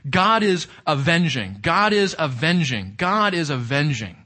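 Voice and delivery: threefold "God is avenging", punching "God" each time; weighty triple stress on "God"; emphatic repetition. Shown as a demonstration. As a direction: hammer "God" three times over. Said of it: "Emphasizing it, making it emphatic".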